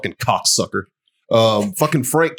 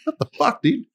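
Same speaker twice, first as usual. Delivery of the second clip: mock shock. Retold reaction to friend.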